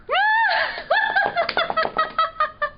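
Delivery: a squire cracking up at his pun; high giggle. A narrator's reading. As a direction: laugh at your own joke. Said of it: "He giggled when he said it".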